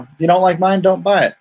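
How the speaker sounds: ordinary talk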